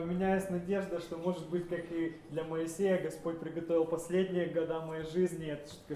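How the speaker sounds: usual speaking voice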